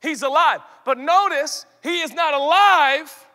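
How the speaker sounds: loud, triumphant preaching; exultant proclamation. Real, not acted. Preacher announcing Adam's first breath, loud and exultant. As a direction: proclaim it like good news.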